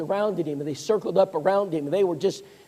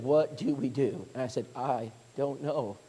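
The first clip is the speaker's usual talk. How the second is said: much softer, lower, slow and lost; helpless hush; 7 semitones lower, 9 dB quieter than usual. Retold or imitated. Elders and preacher at a loss, hushed and slow. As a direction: let the words sink, helpless.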